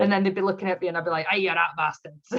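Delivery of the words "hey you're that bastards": hammed-up bad Scottish accent; terrible Scottish accent. Player calling the others bastards, in terrible mock Scottish. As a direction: do a poor Scottish impersonation. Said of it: "Terrible Scottish".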